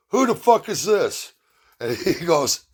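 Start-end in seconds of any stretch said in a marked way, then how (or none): 0.1-1.3 high, snippy imitation of a teen daughter; mock high teen attitude; rude daughter. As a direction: pitch up and snap like a bratty girl. Daughter voiced, rude phone answer.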